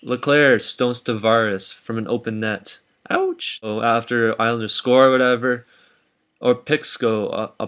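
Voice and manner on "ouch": very high-pitched yelp of mock pain; high mock ouch. Host reacting to Tavares being stoned, high mock ouch.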